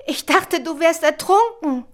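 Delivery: relieved words out of breath; gasping. A narrator's reading. Ronja thought Birk had drowned, gasping. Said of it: "keuchte Ronja".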